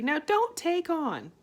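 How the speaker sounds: calm her down; soothing daughter. A narrator's reading. Arietti soothing her upset mother, slow.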